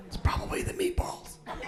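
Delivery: whispered confession. Acted. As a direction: whisper it like a secret. Confiding about gas.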